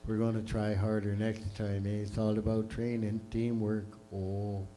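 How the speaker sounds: low, soft, laid-back Canadian hockey player; mock Canadian post-game interview; Canadian accent. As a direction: mumble it like a humble Canadian player after a loss. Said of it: "a Canadian accent".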